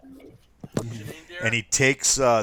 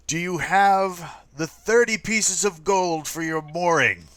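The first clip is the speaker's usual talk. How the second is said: raised, formal official voice; harbor officer; 9 semitones higher, 5 dB louder than usual. Acted. Harbor officer asks the captain for the mooring fee, raised. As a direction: demand the fee politely but firmly.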